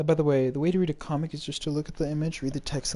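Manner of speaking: normal talking voice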